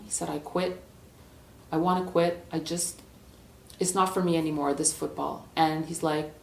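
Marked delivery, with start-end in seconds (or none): 0.3-5.5 soft, halting player giving up; subdued resignation. Acted. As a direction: quietly admit defeat to the coach. Player quietly quits to coach.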